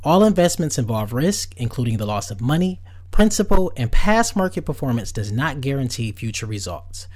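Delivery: loud, rushed disclaimer read; fast legal disclaimer. Acted. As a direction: rattle off the fine print.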